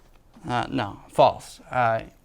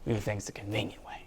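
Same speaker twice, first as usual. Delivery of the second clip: whispering; 4 semitones lower, 13 dB quieter, faster than usual. Real, not acted. Whispered aside to camera.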